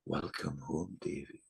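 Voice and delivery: very soft, low imitation of a spirit's greeting; softly imitated loving welcome; hushed welcoming voice. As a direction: gently voice a woman welcoming someone home, almost under the breath. Vision woman's gentle greeting, acted.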